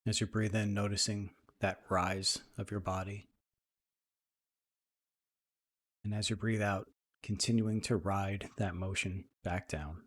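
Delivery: soft, even meditation voice with long pauses; guided meditation. Acted. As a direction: ease listeners into calm, leaving space between phrases.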